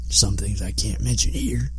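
secretive hushed aside; whispering. Real, not acted. Teasing aside about his writing.

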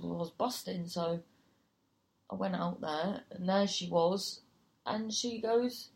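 usual speaking voice

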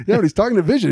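high, laughing through words. Real, not acted. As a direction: giggle while explaining. Laughing at the floating head.

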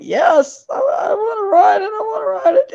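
put-on high, tearful kid's plea, voice cracking; high crying plea of a frightened kid. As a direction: imitate a scared boy pleading through tears. The kid was standing there crying.

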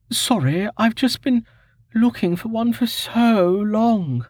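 high female voice, a touch deflated; apologising while explaining her long search; high, slowing, lightly let-down voice; disappointed young woman. Acted.

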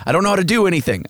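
high, hammed-up helpless wail; mock helpless whining. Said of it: "where I'm all".

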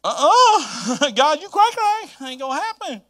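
hammed-up high-pitched you're crazy; mock outraged refusal. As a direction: squeal it like no way on earth. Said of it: "I can imagine if".

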